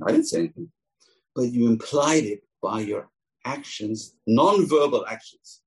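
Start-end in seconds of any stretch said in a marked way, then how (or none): 0.1-0.7 mock innocence. Winking gossiper acting innocent.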